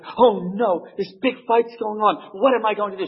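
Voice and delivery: put-on high, anxious young priest voice; panicky young priest. Young priest panicking to himself about the fight, high and anxious. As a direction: fret like a nervous young curate. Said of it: "he's like".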